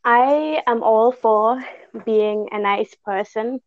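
ordinary talk, nothing marked

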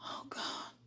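breathless whispered oh God; barely voiced plea to God; a whisper under the breath; whispered prayer. Real, not acted. Overwhelmed, praying alone in bed.

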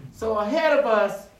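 ordinary talk

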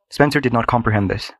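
ordinary delivery

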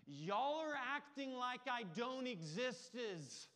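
put-on high, loud, slow child's yell; little Piper finally yelling at her brothers; little girl yelling. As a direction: shout it like a fed-up little girl. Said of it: "Piper yells".

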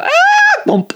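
sudden high yelp then a dull bump; mock impact yelp. Swinger thudding into the tree, mock high yelp. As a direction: squeak like you just hit the tree. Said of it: "kinda go".

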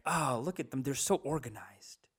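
soft, hushed swooning reverie; murmured mock longing; mock admiration. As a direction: imitate someone secretly enamored, sighing dreamily. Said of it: "kind of being like".

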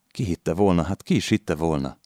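ordinary delivery

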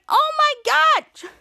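very high, drawn-out exclamation; mock shriek. Girl reacting to the side effects, shrieking. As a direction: cry out in stretched, hammed-up horror.

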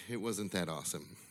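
ordinary talk, nothing marked

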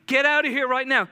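high, loud, rushed urging; frantic. Retold or imitated. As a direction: push them out the door in a panic.

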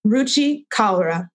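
ordinary delivery

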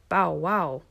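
lightly voiced storybook bow-wow; barking. A narrator's reading. Waggly-tailed duck helps call mother, mild barking. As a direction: bark the words like a friendly dog. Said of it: "barked the do-, duck with the waggly tail".

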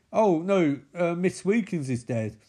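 normal talking voice

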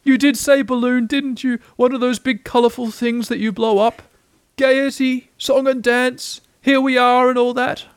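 high, rising wonder; Eeyore, amazed. A narrator's reading. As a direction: list each festive thing in high-pitched astonishment.

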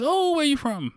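very high, delighted question; pumped stranger. Retold or imitated. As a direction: mimic an eager new acquaintance. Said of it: "super pumped to talk to me".

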